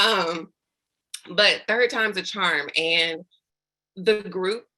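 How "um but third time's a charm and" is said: ordinary delivery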